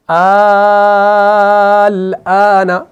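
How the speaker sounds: अल आना with the first vowel stretched extremely long; long mad. Shown as a demonstration. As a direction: chant अल आना, holding the vowel as in recitation. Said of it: "ठीक है".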